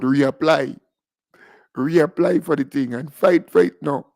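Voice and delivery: usual speaking voice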